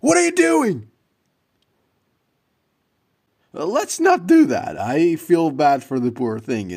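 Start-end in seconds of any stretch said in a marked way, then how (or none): 0.0-4.8 high, loud alarmed call; alarmed protest. Acted. Man stopping a stranger hurting a cat, alarmed. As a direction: rush in to stop them.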